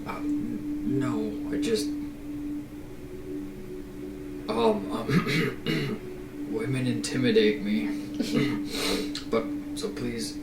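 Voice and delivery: hesitant, halting timid man with throat-clearing. Acted. Nervous man admits women intimidate him, timid and halting. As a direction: lightly play him meek and stammering. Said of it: "in a very timid voice".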